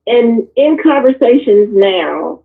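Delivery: normal talking voice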